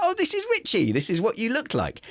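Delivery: high, delighted "this is Richie"; teasing wife. Retold or imitated. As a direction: point at the old photo, delighted. Wife showing old photo.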